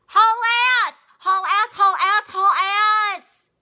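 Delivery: loud repeated yell. Retold or imitated. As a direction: yell it over and over. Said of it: "who just yells the whole time".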